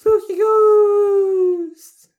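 very high, drawn-out mock ghost howl; spooky ghost wail. Co-host hamming up the spooky stories sign-off, ghost wail. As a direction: wail it slowly and loud, hammed-up.

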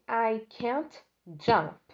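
"I can't jump" stretched word by word; very slow model sentence. Shown as a demonstration. Teacher modeling the negative sentence, very slow. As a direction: drag it out for learners. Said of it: "E depois você tem assim".

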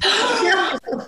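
high, dramatic gasp of awe; mock swooning intake of breath; lovestruck gasp. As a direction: gasp as if love-struck at first sight. Acting out instant lovestruck reaction.

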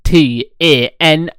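ordinary talk, nothing marked

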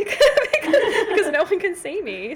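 high, loud laughing words. Real, not acted. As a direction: laugh through the excuse. Laughing at being teased.

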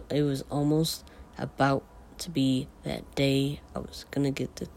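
usual speaking voice

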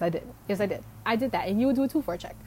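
normal talking voice

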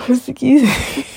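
a real yawn stretching the words. Real, not acted. Tired host yawning into the podcast mic. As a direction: excuse me through a yawn. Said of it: "sorry for yawning".

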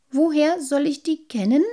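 a lightly voiced small creature, confused; puzzled little sprite. A narrator's reading. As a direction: sprite replying to the boys, bewildered. Sprite answers boys.

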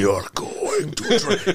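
put-on German accent. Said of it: "in that crazy German accent".

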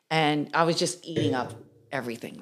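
ordinary talk, nothing marked

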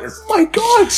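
hammed-up sudden discovery at the mirror; mock shocked realisation. Wrestler at the mirror discovering he's ginger, mock shock. Retells his mirror ad-lib line.

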